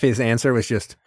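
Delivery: normal talking voice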